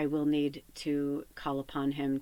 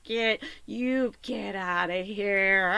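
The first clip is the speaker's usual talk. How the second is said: very slow drawn-out get out; Kristen Wiig bit; much slower than usual. Retold or imitated. Quoting the SNL sketch, slow drawn-out dismissal. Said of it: "skit with Kristen Wiig".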